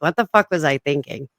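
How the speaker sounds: ordinary talk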